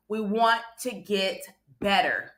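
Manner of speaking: normal talking voice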